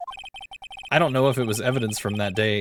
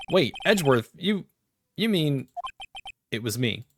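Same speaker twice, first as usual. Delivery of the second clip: ordinary delivery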